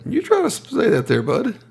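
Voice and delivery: teasing. Real, not acted. Teasing a friend.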